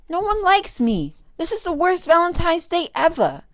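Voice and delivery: loud, high angry shout. A narrator's reading. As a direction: yell it out in frustration. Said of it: "he shouted".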